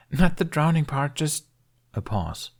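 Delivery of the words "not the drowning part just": put-on young boy voice. Jamie's voice.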